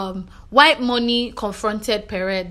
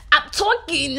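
higher-pitched mock shout at normal volume; imitated bossy shout; 6 semitones higher than usual. Housemate snapping at others who talk over him, mock shouting. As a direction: mimic someone scolding for being interrupted. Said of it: "The way he will shout".

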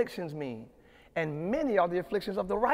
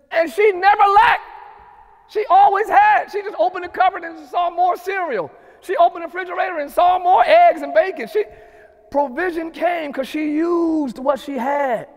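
loud, high, shouted preaching; preacher lifting the crowd on provision; preaching at full force; 9 semitones higher, 11 dB louder than usual. Acted. Pastor shouting to the online church about provision, full-throated preaching.

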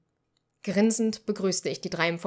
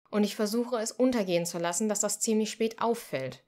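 usual speaking voice

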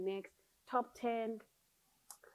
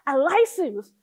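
excited; 11 semitones higher, 12 dB louder than usual. Real, not acted. Excitedly teasing about rival.

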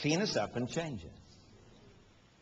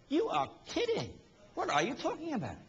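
high, disbelieving exclamation; you can't be serious; imitating a shocked listener; incredulous crowd; 12 semitones higher than usual.

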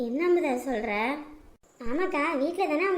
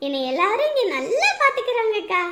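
ordinary talk

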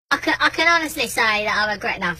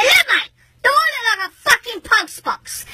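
high, heated interruption; insistent outrage; 11 semitones higher than usual. Retold or imitated. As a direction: cut in and press the question harder. Cuts in, repeats the question.

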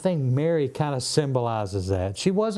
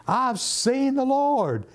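much higher, slow, proclaimed cry; town crier announcement; 11 semitones higher, slower than usual. Acted. Mary announcing to the disciples, slow high proclaiming cry. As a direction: call it out to the whole world, slowly. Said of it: "It's kinda like the town crier".

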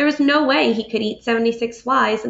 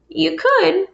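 gentle father; 7 semitones higher than usual. Acted. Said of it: "he said".

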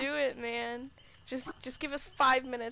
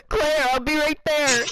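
loud heroic game line; Leon voice line; 7 dB louder than usual. Retold or imitated. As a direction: belt it like a game hero. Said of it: "imagine mine's just like".

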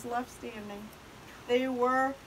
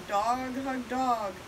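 plain reading voice

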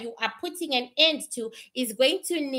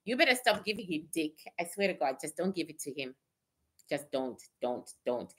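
hushed warning; 8 semitones lower, 8 dB quieter than usual. Pretend. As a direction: drop to a low hush, almost whispering. Confiding advice to baby mamas.

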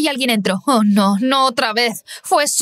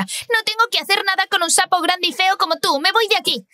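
grito agudo y enfadado, sin mucho volumen; suéltale el insulto a la maestra, indignada; 8 semitones higher than usual. A narrator's reading. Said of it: "le gritó a la maestra". (una niña furiosa le grita a su maestra)

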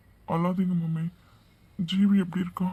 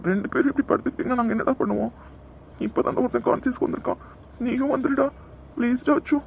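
desperate pleading; 5 semitones higher than usual. Acted. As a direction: cry out to him, almost breaking. Friend begs unconscious man to wake.

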